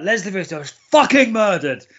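mock aggressive outburst, pitched high; hammed-up angry cop. Imitating the cop's aggressive tone, mock outraged. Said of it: "the same aggressive tone".

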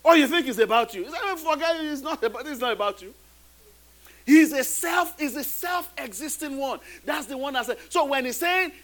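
usual speaking voice

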